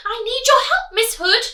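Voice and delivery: high, loud piglet; anxious piglet plea; pig pleading. Acted. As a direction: beg for help. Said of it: "Pig cried".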